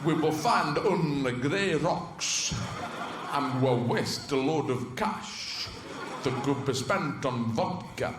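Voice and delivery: slow, deliberate mock Russian accent; dubious Russian accent. Russians discussing a pointless moon trip, mock Russian accent. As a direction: put on a wandering Russian accent. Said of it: "But with a Russian accent".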